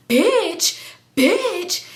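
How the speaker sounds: sharp rise and drop, mock outrage; indignant retort. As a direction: act out affronted disbelief. Acted shocked retort to insult.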